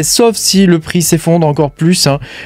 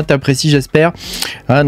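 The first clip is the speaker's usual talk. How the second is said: normal talking voice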